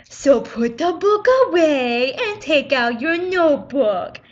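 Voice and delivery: slow, drawn-out mocking teacher voice; rude mocking imitation. Teacher ordering the book away, in slow rude mockery. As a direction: drag out the order in a mock-rude voice. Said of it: "that was kind of really rude".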